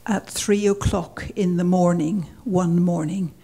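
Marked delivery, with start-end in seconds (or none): none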